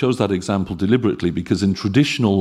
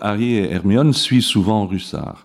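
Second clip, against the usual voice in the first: Harry et Hermione suivent souvent Russard in French sounds; French accent. Shown as a demonstration. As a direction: read the sentence as a French speaker. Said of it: "we have".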